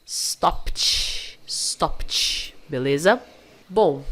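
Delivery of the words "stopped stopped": STOPPED as "stopt" with an airy released T. Shown as a demonstration. Teacher brazilianizing the pronunciation for learners, ED as T. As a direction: hiss the PT ending of STOPPED. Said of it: "é como se você falasse".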